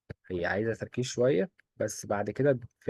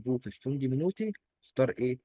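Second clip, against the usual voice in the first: usual speaking voice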